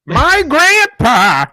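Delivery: put-on high, slow old man voice. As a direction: creak it out like a grandpa. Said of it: "why are you talking like that again? Guys, it's the old man voice".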